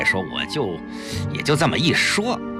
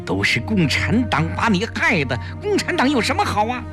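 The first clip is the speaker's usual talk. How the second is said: high-pitched, quick scolding; speaking out loudly; 9 semitones higher than usual. A narrator's reading. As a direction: scold so that eavesdroppers hear. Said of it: "季嫣然大声地说". (高声数落)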